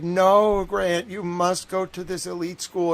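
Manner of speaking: a hint of an insistent mother, loud; insistent mom. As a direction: push it like a mom who won't budge. Mom insisting on elite school.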